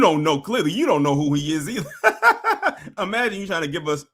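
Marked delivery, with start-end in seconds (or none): 1.9-2.9 real laugh. Real, not acted. Laughing at the host.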